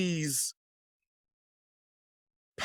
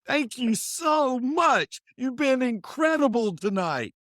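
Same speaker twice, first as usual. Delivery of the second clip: rock star farewell; 3 dB louder than usual. Pretend. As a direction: thank the crowd like a rock star. Mock rock star concert farewell.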